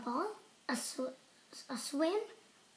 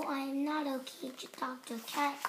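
usual speaking voice

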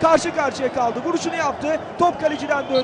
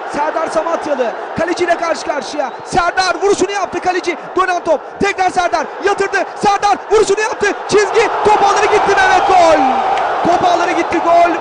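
rising to a loud, high goal yell; excited play-by-play; 4 semitones higher, 7 dB louder than usual. Real, not acted. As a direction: build from urgent to all-out roar. Attack builds into a goal.

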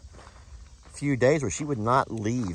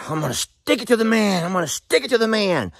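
mock defiant; 10 semitones higher, 5 dB louder than usual. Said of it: "like Tex teaches us".

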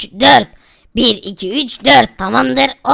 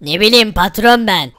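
lower-voiced henchman answering back; 5 semitones lower than usual. Henchman tells his boss he has no idea, low character voice. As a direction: play the underling, pitched down.

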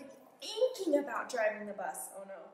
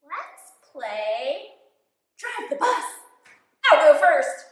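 high, laughing, louder pigeon; eager pigeon; 6 semitones higher, 13 dB louder, slower than usual. Acted. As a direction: jump in gleefully.

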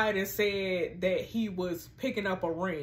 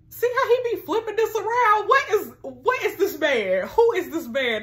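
loud, high, slowed outrage at him; indignant outburst; 8 semitones higher, 5 dB louder than usual. Real, not acted. Outraged at the boyfriend flipping the blame, loud indignation. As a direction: call him out, incredulous.

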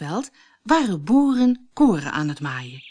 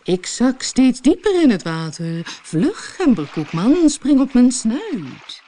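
louder, urgent fox cry; fox calling out in alarm; 3 semitones higher, 4 dB louder than usual. A narrator's reading. The fox in the river urging the gingerbread man, louder and urgent. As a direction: sound panicked, hurry him along. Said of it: "riep hij opeens".

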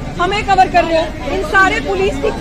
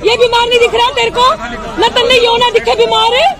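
raised, higher-pitched indignant demand; angry challenge; 4 semitones higher than usual. Real, not acted. Protester confronting police over a sick person, angry challenge.

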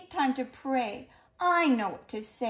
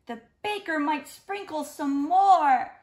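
mock alarm; 4 semitones higher, 7 dB louder than usual. Cat shudders at sprinkles.

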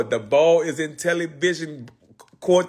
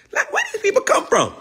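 squeaky disbelief. Real, not acted. Baffled by the online beef.

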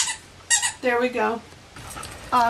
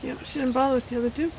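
ordinary delivery

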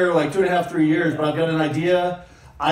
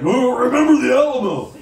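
higher, louder smug Texan question; mocking Texan; 5 semitones higher, 4 dB louder than usual. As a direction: parody a proud Texan.

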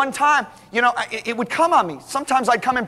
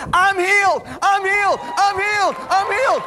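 excited shout; 9 semitones higher than usual. Retold or imitated. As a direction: shout it like she was just healed. Mother-in-law jumping up healed.

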